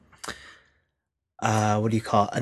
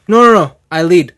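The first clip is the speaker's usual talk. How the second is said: put-on insistent, loud, higher Italian-accented refusal; mock Italian accent, firmly taking charge; imitated Italian coach; 5 semitones higher, 9 dB louder than usual. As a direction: overrule them as the Italian coach. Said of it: "then the coach is like".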